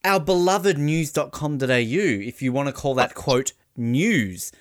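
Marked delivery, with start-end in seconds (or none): none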